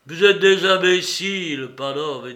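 loud, abrupt insult, dropping at the end; brusque. A narrator's reading. Mitya insults the two pans, brusquely. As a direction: blurt it out with rough impatience. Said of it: "dit brusquement Matya".

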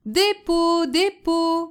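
high, drawn-out hawking call; street vendor's cry. A narrator's reading. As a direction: hawk your wares through the streets. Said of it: "continua-t-il".

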